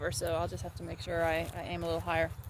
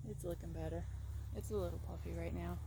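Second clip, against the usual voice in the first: ordinary delivery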